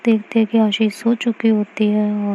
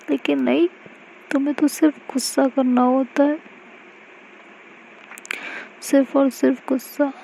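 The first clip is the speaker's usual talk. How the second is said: crying while talking; 4 semitones higher than usual. Acted. Mahi to hurt Aashi, tearful reproach. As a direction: scold her through tears, mildly. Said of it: "रोने लगती है और कहती है".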